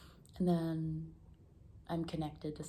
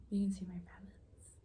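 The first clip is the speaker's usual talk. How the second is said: whisper; 2 semitones higher than usual. Real, not acted. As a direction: whisper so the rabbits stay calm. Speaking quietly near the rabbits.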